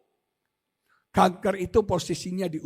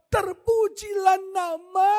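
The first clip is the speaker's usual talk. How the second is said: Job praising God; 9 semitones higher, 4 dB louder, slower than usual. Retold or imitated.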